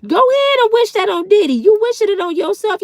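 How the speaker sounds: mocking taunt. Real, not acted. Scolding a hater in the chat.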